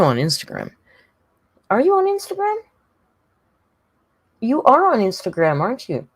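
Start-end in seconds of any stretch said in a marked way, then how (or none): 1.7-6.1 put-on high, sweet baby-talk question; high cooing question to a pet. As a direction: coo at the cat.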